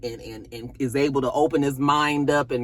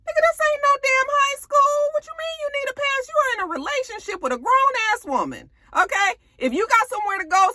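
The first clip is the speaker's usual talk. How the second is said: very high, rapid scolding; imitating an outraged wife; mock furious wife; 11 semitones higher than usual. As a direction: tear into him in a high voice. Tamara chewing him out.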